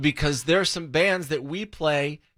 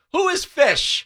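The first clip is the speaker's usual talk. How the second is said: exasperated shout; 12 semitones higher, slower than usual. Real, not acted. Host naming a band he doesn't care about, exasperated shout.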